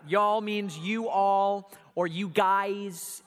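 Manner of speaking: slow, stretched Southern drawl on y'all; slow Southern accent. Shown as a demonstration. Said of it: "Here's some redneck words".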